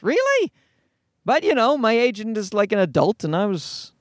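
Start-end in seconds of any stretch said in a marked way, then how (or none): 0.0-0.5 incredulous really. Retold or imitated. Disbelief about calling cops.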